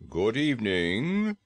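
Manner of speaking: slow, measured frog-man greeting; a lightly voiced dignified frog saying hello; polite frog bidding good evening; Frogman greeting.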